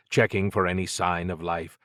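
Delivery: ordinary delivery, nothing marked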